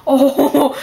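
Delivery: amused laugh breaking out. Real, not acted. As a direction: laugh through the "oh". Laughs at the trolled player.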